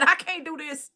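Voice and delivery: high, distressed protest; pleading to get out of there; new aide overwhelmed on her first day; retold overwhelm. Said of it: "I said".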